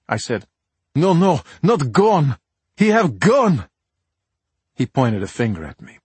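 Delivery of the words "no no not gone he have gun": put-on slow, insistent Russian accent; thick Russian accent. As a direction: correct him in heavy Russian accent. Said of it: "in a thick Russian accent".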